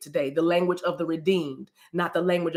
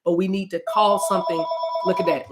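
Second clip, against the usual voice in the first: usual speaking voice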